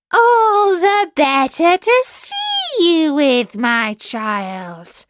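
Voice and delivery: high, loud slow granny voice; wolf as grandmother. Acted. Wolf in bed answering the girl in a granny voice. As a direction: draw it out with fake sweetness.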